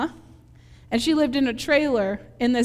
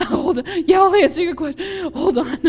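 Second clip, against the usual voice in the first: out of breath; 4 semitones higher, 5 dB louder than usual. Retold or imitated. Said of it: "you're like".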